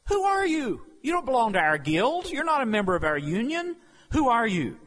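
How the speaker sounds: high, loud, indignant questioning; challenging delegation. Acted. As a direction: demand who he is, affronted. Delegation challenging John, acted.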